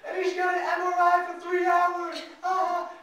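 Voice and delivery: high, loud jeering mock laughter; mock him like a cruel crowd; mocking nurses. Imagined nurses jeering at the patient, high and loud. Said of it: "It was like".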